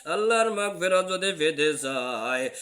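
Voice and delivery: high, loud, slow sung proclamation; divine call. Retold or imitated. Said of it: "ডাক দিয়া বলতেছে".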